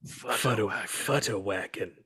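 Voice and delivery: slow, low, soft Futterwacken; co-host mimics the clip, slow and low; imitating the Hatter. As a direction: drag out Futterwacken like the movie. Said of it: "He said it".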